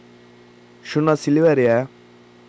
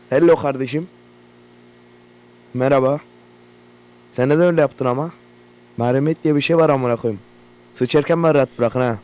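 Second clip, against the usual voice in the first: usual speaking voice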